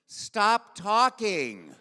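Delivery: loud, high, drawn-out order to stop; shouted interruption. Retold or imitated. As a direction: imitate an exasperated director, stretching each word loudly. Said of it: "all of a sudden I heard".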